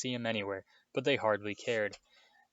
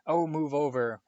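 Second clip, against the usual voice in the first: loud, raised-pitch exasperation; impatient snarl to shove someone aside; 4 semitones higher, 6 dB louder than usual. A narrator's reading. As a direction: snap it out, fed up. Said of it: "Hermione snarled".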